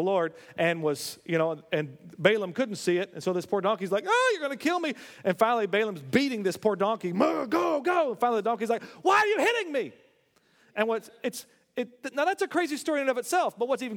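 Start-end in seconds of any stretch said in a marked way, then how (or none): 4.1-4.9 high, whiny donkey pleading for its life; a higher, pleading donkey voice. Retold or imitated. Donkey voiced in retold story. 7.1-8.1 urging the donkey. Retold or imitated. Balaam urging the beaten donkey. 9.0-9.9 indignant donkey. Retold or imitated. Donkey cries out at Balaam.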